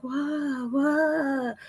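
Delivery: put-on high, soft puzzled "what, what?" from a kid; whiny student.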